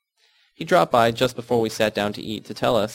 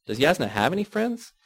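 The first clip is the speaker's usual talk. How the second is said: sarcastic; 6 semitones higher than usual. A narrator's reading. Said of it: "Myrna asked sarcastically".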